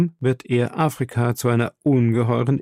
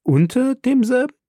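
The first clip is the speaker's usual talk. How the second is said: much higher, incredulous rising question; astonished echo; 13 semitones higher than usual. A narrator's reading. Professor questions the captain's claim, astonished. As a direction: echo it back in high-pitched disbelief.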